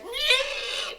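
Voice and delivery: high-pitched creature squeal; mock Strigoi shriek, very high; imitated monster screech.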